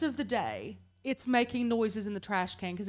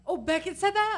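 high, loud disbelief; shocked; 9 semitones higher, 5 dB louder than usual. Real, not acted. Cohost hearing the son swore, in loud shock. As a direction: gasp out the question in real shock. Reacting to the swearing story.

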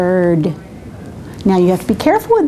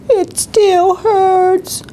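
whiny, crying; 8 semitones higher, much slower than usual. Acted. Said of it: "Bird kept crying".

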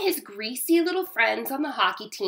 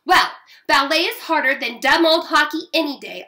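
loud, raised indignant retort; hollering; 5 semitones higher, 6 dB louder than usual. A narrator's reading. Sister defends ballet against hockey, hollering. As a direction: holler it in a sibling quarrel. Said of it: "I hollered".

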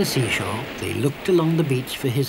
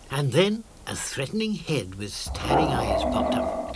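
ordinary delivery

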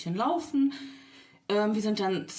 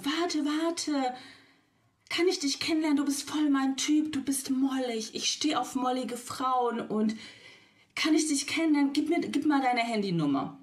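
imitating the man; 6 semitones higher than usual. Imitating the stranger asking for her number, eager and pushy. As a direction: mimic an overeager guy chatting her up. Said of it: "Der so".

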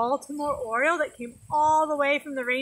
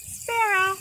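mock gasp; 7 semitones higher than usual. Shown as a demonstration. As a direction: gasp as if you spot it. Spotting game.

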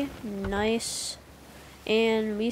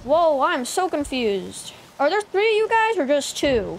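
baffled; 5 semitones higher, 5 dB louder than usual. Real, not acted. Confused by enemy ships.

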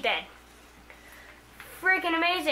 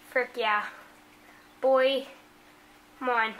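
ordinary talk, nothing marked

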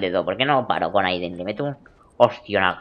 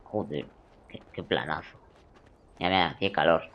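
ordinary talk, nothing marked

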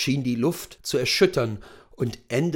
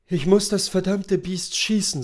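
jämmerlich; 6 semitones higher than usual. A narrator's reading. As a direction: say it wretchedly, without force. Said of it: "sagte Macomber jämmerlich". (leicht jämmerlich, etwas höher)

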